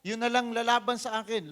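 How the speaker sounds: booming, high, loud challenge to the army; taunting giant. Acted. Goliath shouting a challenge at the whole army, booming taunt. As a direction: bellow it like a giant daring anyone to fight.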